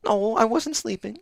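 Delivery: high, slow flustered protest; startled denial. Retold or imitated. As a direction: squeak out a guilty denial. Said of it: "and then I'm like".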